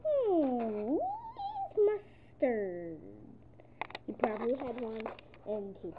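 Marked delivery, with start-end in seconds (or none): 0.0-3.0 imitating pigeon. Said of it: "he said".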